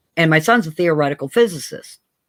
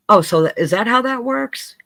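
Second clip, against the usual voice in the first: feigned understanding; 7 semitones higher than usual. Retold or imitated. Feigns following son's explanation.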